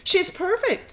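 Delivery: high, bright mother's delight. Acted. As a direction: coo it with joy. Mother delighted over newborn.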